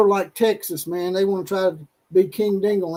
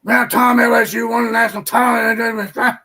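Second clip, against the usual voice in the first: a light, laughing, louder put-on of the coach; half-shouted mock of the old coach through a laugh; lightly voiced Louisiana coach, shouted with a laugh; channeling Ed Orgeron; 5 dB louder than usual. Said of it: "channel my inner Ed".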